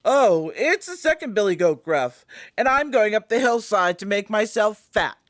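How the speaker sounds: fuller middle goat voice, moderately high; not-so-small goat voice. A narrator's reading. Second billy goat answers the troll, in a not-so-small voice. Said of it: "who hadn't such a small voice".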